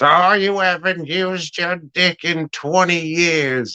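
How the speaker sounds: wife jeering at her husband, put-on sneer; mocking nagging wife. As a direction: taunt him like a scornful wife. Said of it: "And then she says".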